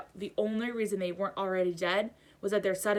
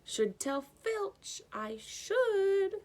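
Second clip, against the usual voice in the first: high, slow mock-pious poltergeist; saintly voice; 12 semitones higher, much slower than usual. A narrator's reading. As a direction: sound falsely virtuous. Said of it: "said Peeves in a saintly voice".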